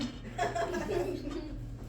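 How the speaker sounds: unvoiced high giggle; high breathy laugh. Real, not acted. Teacher laughing at his own joke, airy and high.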